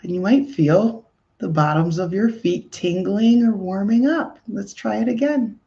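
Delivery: calm indoor voice leading breathing; guided meditation. Acted. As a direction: gently guide listeners to breathe into the heart. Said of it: "I'll turn on my indoor voice".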